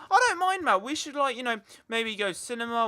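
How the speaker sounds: high, lively imitation of a willing friend; chatty mate. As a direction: play the mate happily throwing out suggestions. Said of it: "and they elaborate a bit more".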